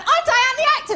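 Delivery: high, loud acted blurt; hammed-up high announcement; blurted introduction. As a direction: call it out too eagerly. Both blurt out their introductions.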